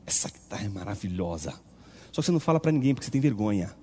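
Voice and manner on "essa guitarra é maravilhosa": much lower and softer, in private awe; hushed admiration. Retold or imitated. Musician admiring a guitarra he privately wants, hushed awe. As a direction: murmur it like a secret longing.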